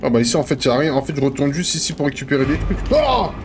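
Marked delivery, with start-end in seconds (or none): 2.9-3.3 short, high yelp of fright; real startled shriek; startled scream. Real, not acted. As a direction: cry out at a jump scare. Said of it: "ça m'a fait peur".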